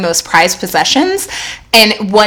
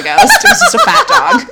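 laughing. Real, not acted. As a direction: burst out laughing on the last words. Punchline of the ghost story.

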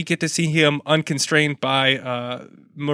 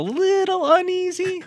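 mock ominous; slower than usual. Spooky joke about German chants.

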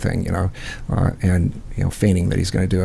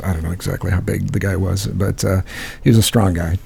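normal talking voice

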